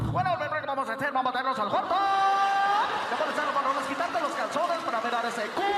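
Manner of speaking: loud booming Spanish DJ voice; announcer voice at home. Retold or imitated. Radio host answers his wife, booming announcer. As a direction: seduce like a cheesy radio host. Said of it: "goes home talking like that".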